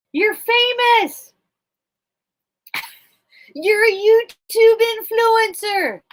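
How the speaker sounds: high, loud, slow teasing praise; loud pet talk. Real, not acted. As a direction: gush at the bird, laughing. Teasing the bird.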